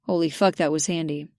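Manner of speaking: ordinary delivery, nothing marked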